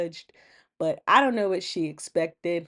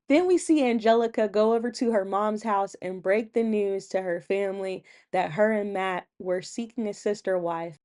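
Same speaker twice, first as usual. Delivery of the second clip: ordinary delivery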